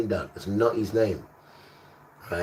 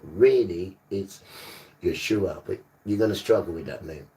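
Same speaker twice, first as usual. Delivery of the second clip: normal talking voice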